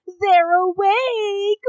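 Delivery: high, slow, gleeful announcement; excited tribe. Retold or imitated. Tribe gleefully announcing the couple is awake. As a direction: draw it out like gossip spreading. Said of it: "the whole tribe is basically like".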